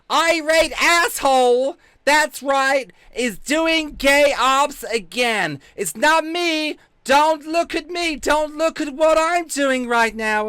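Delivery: very high, loud, slow put-on voice; mock accuser voice. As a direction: drag it out in a silly high-pitched denial. Acts out high mocking accuser voice.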